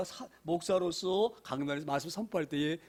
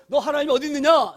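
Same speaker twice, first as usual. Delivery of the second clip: higher, louder taunting question; mocking taunt; 7 semitones higher, 9 dB louder than usual. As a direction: jeer it at him. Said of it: "이렇게 묻습니다". (조롱하듯 높고 크게 묻는 말)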